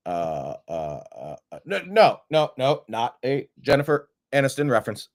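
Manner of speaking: halting, low uh uh filler; mock stammering. Pretend figure begging to stay friends, stammering lightly. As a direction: stumble through it, slowing at the end.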